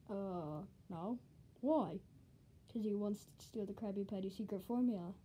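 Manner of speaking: low and soft; quiet. Acted. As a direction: keep it hushed and low. Quiet low dim dialogue.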